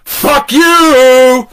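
high, loud yelled taunt; Coyote yelling. Retold or imitated. Coyote taunting in Gary's poem, loud mocking yell. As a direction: fling it out like a trickster. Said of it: "sang Coyote".

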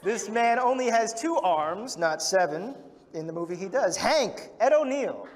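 loud, playful build-up to each name; announcer introduction. Acted. Host builds up to the last cast member, big announcer style. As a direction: hype the next star to the crowd.

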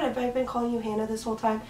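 normal talking voice

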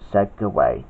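ordinary delivery

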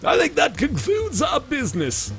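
villain voice. Acted. Game character voice, villain.

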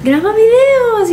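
high, slow coaxing voice; imitating boyfriend. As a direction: egg her on, high and drawn out. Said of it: "he's the one that's always, like, trying to".

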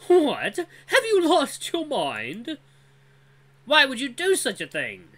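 put-on high, furious young male voice; outraged and shouting; angry young male. Dran angrily confronts Vul.

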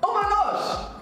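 high, thrilled squeal; high-pitched delighted cry; overjoyed. Real, not acted. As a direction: celebrate a surprise win. Just told she won.

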